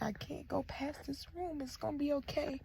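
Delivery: hushed, high, tender reassurance; acted-out emotional nurse, very soft; imitated tearing up. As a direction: whisper comfort as if close to tears. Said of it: "tearing up".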